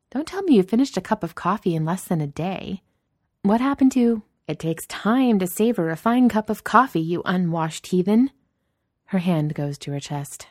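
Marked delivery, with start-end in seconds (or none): 0.1-8.3 higher, teasing boss voice; Danny, mock shock. Boss teasing a coffee snob, mock shock. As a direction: throw his snobbery back at him, pitched up.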